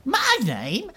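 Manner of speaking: very high, slow, swooning surprise; flattered Bella. Acted. Bella swoons when Saf asks her name. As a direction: melt at being asked. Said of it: "she's like".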